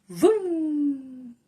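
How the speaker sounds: revving car noise; playful vroom sound; engine vroom. Acted. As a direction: make the go-kart roar. Kart sound effect in story.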